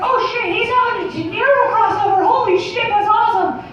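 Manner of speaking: high, excited cartoon falsetto; high-pitched mouse voice, thrilled; Mickey Mouse. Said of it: "He's like".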